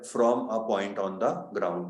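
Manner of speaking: very slow, word-by-word reading; broken-up, unhurried reading. Shown as a demonstration. Teacher reading what follows "from", slow and deliberate. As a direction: stretch it out piece by piece. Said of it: "तोड़ के पढ़ो आराम से".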